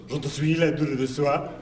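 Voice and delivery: mock French driver babble; French gibberish. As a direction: rattle off fake French. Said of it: "The driver turns and goes".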